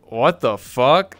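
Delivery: replaying a stunned reaction, drawn out; retold disbelief. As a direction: draw it out in baffled disbelief. Retold baffled reaction to rejection.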